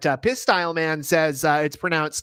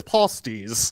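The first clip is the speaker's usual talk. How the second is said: British accent; 6 semitones lower than usual. Shown as a demonstration. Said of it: "say it with a British accent".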